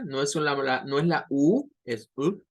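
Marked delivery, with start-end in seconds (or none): 1.8-2.4 a short, low u vowel; shorter U. Shown as a demonstration. Teacher contrasting the short u. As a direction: keep the u brief. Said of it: "Es una U más corta".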